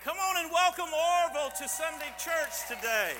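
big, high announcer call to the room; rally the whole church to cheer; loud welcome. Real, not acted. Pastor announcing Orville to the church, loud welcome.

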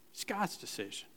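"God's" said very softly, almost breathed; quiet echo of It's God's decision; hushed repeat. Shown as a demonstration. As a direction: let the repeat fall to a hush.

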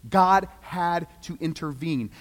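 usual speaking voice

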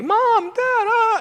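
mock-panicked child running to mom and dad. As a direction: squeal for your parents in alarm. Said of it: "you might come in like".

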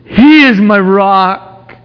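slow, high, forceful declaiming; preaching. Acted. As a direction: proclaim it slowly from the pulpit. Preacher declaims the psalm verse.

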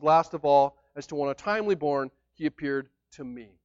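measured, solemn Bible reading; scripture reading. Acted. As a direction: read the verses to the congregation with weight. Reads scripture aloud.

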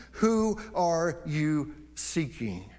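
drawn out, very slow, weighty; very slow searching question; slow emphatic question. Shown as a demonstration. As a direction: let each word land slowly. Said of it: "preacher repeats question for emphasis".

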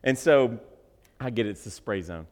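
ordinary talk

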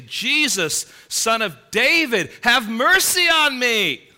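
loud, high, pleading call, slowing at the end; desperate cry out. Acted. Blind beggar crying out to Jesus, loud pleading call. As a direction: cry out to him for mercy across the crowd. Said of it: "moved to cry out and say".

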